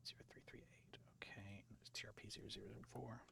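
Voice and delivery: hushed whisper of numbers. Real, not acted. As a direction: whisper it under your breath. Repeating coordinates under breath.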